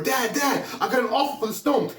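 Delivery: high, laughing rush of news; excited boy. Acted. Son bursting in to tell dad, laughing excitedly.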